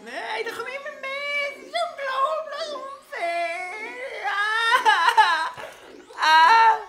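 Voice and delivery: high, loud wailing through laughter; laugh-crying complaint. Real, not acted. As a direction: whine it, laughing and crying at once. Raging at enemy swarm.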